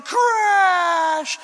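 high, loud dramatic crash; mock crash shout. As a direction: cry out the crash like slow motion. Acting window crash in slow motion.

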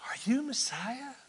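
unvoiced hushed question; secret whisper. Nicodemus asking Jesus in secret, whispered. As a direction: ask it in secret at night.

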